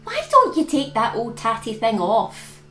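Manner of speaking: plain reading voice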